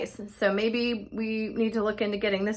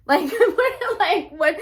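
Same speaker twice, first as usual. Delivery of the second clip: laughing disbelief; 9 semitones higher, slower than usual. Real, not acted. Baffled by neighbor's panic.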